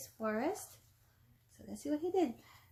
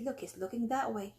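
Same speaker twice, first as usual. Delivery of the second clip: plain reading voice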